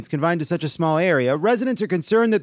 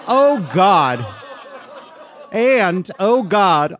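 exclamation; 3 semitones higher, much slower than usual. Real, not acted. Dread at cruise idea.

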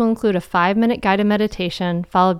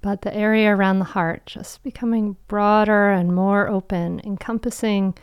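guided meditation. Acted. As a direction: guide them gently into calm.

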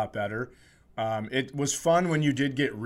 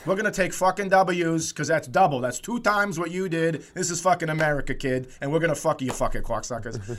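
mock Boston American; 4 semitones higher than usual. American bragging over Canadians about W's, mock Boston accent. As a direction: rant like a cocky Bostonian. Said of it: "we're kinda talking like that".

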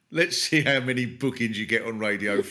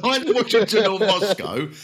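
"Neither Washington nor Moscow" said through laughter, louder; laughing; 8 semitones higher, 7 dB louder than usual. Retold or imitated. Slogan repeated through laughter.

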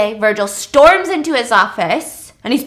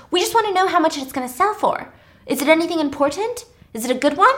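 eager heiress; 6 semitones higher, faster than usual. Retold or imitated. Heiress voiced in story.

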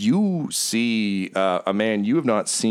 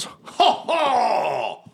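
high, booming heroic blowhard, drawn out; boisterous adventurer; 9 semitones higher, much slower than usual. Acted. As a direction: greet an old rival with huge slow gusto. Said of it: "and he goes".

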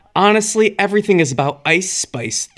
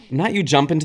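ordinary delivery

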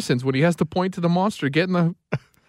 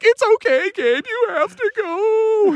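very high, drawn-out tearful wail; mock bawling; slower than usual. Mother sending son into kindergarten, imitated bawling. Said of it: "just starts bawling".

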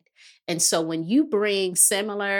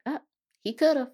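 mock shrug; 8 semitones higher than usual. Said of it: "I'd be like".

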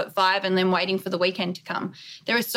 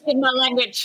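laughing; 6 semitones higher than usual. Real, not acted. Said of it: "laughing reply".

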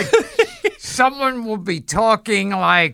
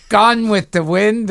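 normal talking voice

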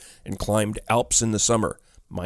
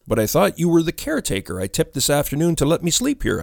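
high-pitched, anxious gasp of confusion; gasped protest; 7 semitones higher than usual. A narrator's reading. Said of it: "I gasped".

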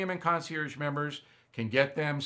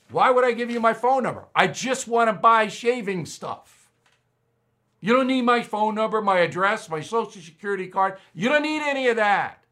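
louder and higher, fast, mock-indignant protest; exasperated retold rant; 7 semitones higher, 6 dB louder than usual. Retelling his protest to the checkout lady, exasperated and loud. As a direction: ham up the outrage at the checkout. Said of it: "and I'm not yelling at her".

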